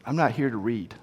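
low, soft deadpan comeback; a lightly voiced slugger's put-down; dry quiet retort. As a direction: say it flat and quiet, unimpressed. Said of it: "he looks at Yogi Berra and he goes".

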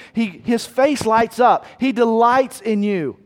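beaming with joy at the congregation; joyful rising. Real, not acted. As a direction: let your face light up as you say it. Preacher describing God's joy.

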